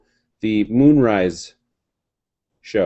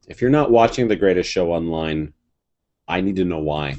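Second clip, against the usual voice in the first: ordinary talk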